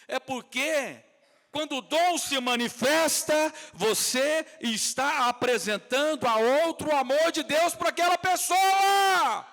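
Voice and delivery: high, loud, slow proclamation; full-force preaching. Real, not acted. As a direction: shout each phrase to the whole congregation, stretching the endings. (pregação inflamada aos brados)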